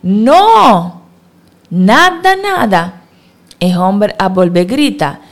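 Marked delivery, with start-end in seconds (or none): 0.0-3.0 loud high answer called from the boat; high, loud shouted reply; shouting back. A narrator's reading. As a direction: shout back across the water. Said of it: "El discípulo a grita back".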